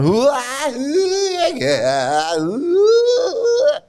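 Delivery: very high whooping cries; mock ecstatic devotee. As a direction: ham up a devotee in ecstatic rapture. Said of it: "you're walking around the room going".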